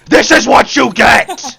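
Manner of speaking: loud high teasing yell; gleeful shout. Real, not acted. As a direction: shout it while tickling. Tickling back, loud.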